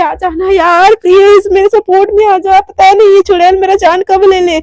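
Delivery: high, loud begging voice; whiny plea for help; pleading whine. Acted. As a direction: beg your friend to rescue you. Mishka begging friend for support.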